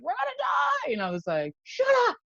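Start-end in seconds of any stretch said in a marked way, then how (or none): 0.0-0.9 high, panicky mock scream. Retelling a panicked stranger in the crowd, high mock scream. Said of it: "a woman screaming her head off". 1.6-2.2 high, sharp retold snap; mock snap. Telling the screaming woman to stop, sharp high snap.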